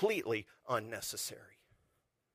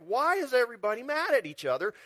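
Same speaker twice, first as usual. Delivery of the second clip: exasperated outcry; 8 semitones higher, 5 dB louder, faster than usual. Retold or imitated. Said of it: "we're like".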